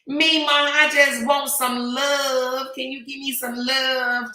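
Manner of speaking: put-on slow, high little-girl country voice; grandbaby asking grandma for love, slow and high; imitating grandbaby. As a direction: plead for a hug like a small country child. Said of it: "my grandbaby country too".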